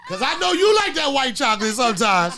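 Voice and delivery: high laughing tease through the words. Real, not acted. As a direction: laugh while teasing your cohost. Teasing cohost, laughing.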